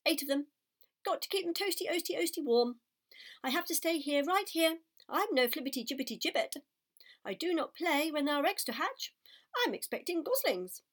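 a bustling mother goose, busy with eggs. Acted. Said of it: "said the goose".

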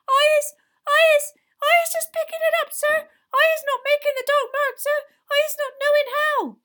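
very high, loud tearful-elf squeak; squealing elf. A narrator's reading. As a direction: protest frantically in a tiny creature voice. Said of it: "squealed Winky".

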